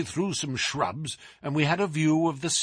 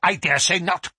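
sharp, louder, higher retort; an irritable professor's curt rejoinder; with asperity; 7 semitones higher, 6 dB louder than usual. A narrator's reading. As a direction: throw it back testily. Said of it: "said the Professor with asperity".

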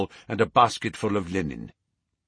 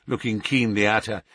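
plain reading voice

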